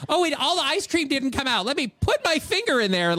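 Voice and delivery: high-pitched imitation of a clueless kid; mock oblivious kid. Mimics kids at ice-cream machine.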